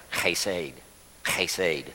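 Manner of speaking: hesed with a raspy throat sound at the start, hay-SAY; throaty hesed. Shown as a demonstration. Pastor demonstrates the guttural hesed to the congregation. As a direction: scrape the h from the back of the throat in hesed. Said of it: "So it's kinda like this".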